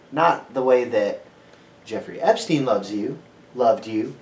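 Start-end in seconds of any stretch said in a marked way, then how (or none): none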